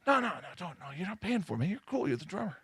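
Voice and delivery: hushed offer. Retold or imitated. Guys at the club softly push a free smoke, hushed. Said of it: "the other guys coming out going".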